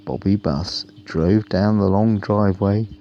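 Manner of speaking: plain reading voice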